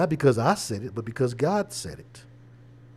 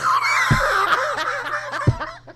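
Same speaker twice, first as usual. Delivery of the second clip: high shrieking laugh; 5 dB louder than usual. Real, not acted. Cracking up at the joke.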